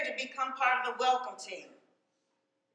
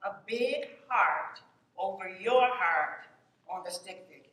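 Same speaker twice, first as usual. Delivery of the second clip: guided exercise; 6 semitones lower than usual. Acted. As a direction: lead them gently through it.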